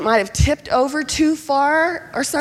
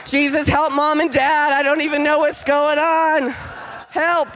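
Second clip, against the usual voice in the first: lightly imitating a fervent intercessor, raised a little higher; Lou Engle prayer; 4 semitones higher than usual. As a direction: pray like a kid doing a fiery prayer leader. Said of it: "turn into Lou Engle".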